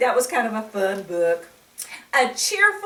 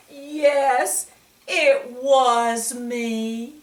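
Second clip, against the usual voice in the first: put-on higher, slow little-boy confession; child admitting; 4 semitones higher, 8 dB louder, much slower than usual. Reader voicing David admitting the mistake, slow and small. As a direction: own up slowly like a small boy.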